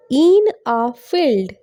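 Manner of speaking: very slow, stretched English phrase; slow teaching pace. Shown as a demonstration. Teacher answering own question for learners, very slowly. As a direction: draw out each word for learners. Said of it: "অনেক আস্তে আস্তে".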